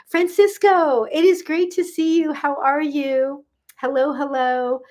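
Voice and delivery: high, sing-out welcome; delighted greeting. Real, not acted. Host welcoming Francisco back to the live, delighted.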